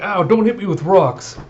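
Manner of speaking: high, whiny mock complaint; playful pained protest; mock whine. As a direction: yelp as the rocks hit. Getting hit with rocks.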